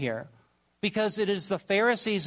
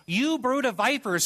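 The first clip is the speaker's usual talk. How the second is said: high, ringing condemnation; Jesus' rebuke; 7 semitones higher than usual. Retold or imitated. Jesus condemning the Pharisees, voiced high by the preacher. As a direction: cry it out as a judgment. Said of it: "where Jesus says".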